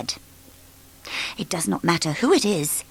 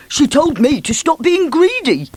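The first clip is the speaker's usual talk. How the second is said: high, louder grumble; indignant Mister Greedy; 9 semitones higher, 4 dB louder than usual. Acted. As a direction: grumble between buns.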